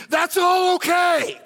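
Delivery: shouted refrain. Real, not acted. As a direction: belt the refrain out to the room. Preacher repeating his refrain.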